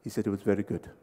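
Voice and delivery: low, soft anticlimactic report; deadpan translator. Retold or imitated. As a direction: imitate an unimpressed interpreter.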